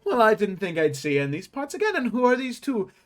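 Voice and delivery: high, eager tailor greeting customers; shopkeeper voice. Acted. GM voicing shopkeeper Albert.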